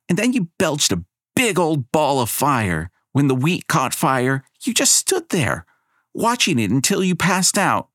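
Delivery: put-on high girl's accusing account; indignant girl. Abigail tells the crowd what Elena did, high and indignant. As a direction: tell it with outrage.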